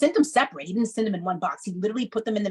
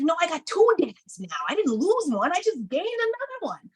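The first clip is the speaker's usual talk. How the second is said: higher, bright insistence on two dads; excited retort, pitched up; upbeat correction; 10 semitones higher than usual. Retold or imitated. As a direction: set them straight with joy. Answering people who pity her.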